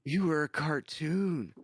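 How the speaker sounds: slow, high imitation of a child; imitated kid. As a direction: draw it out like an amazed kid. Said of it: "He's like".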